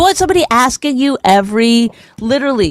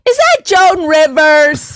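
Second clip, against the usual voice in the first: higher, rising, loud starstruck questions; hammed-up eager husband questions; mock excited wondering; 6 semitones higher, 5 dB louder, much slower than usual. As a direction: ham up a husband sure he knows the actress. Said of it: "you'll be like".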